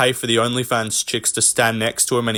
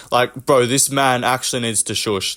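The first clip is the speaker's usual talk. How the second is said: normal talking voice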